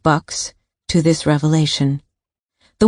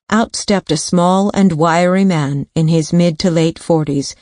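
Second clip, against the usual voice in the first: usual speaking voice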